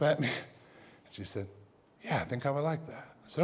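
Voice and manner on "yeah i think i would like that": small, very soft retold answer; hushed. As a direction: accept quietly, a little tentative. Retold reply of shaken girl.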